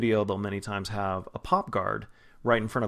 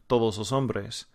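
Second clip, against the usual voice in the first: native Spanish. Shown as a demonstration. Teacher imitating a Spaniard saying all the men, native Spanish. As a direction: say todos los hombres like a native Spaniard. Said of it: "a Spaniard ... saying".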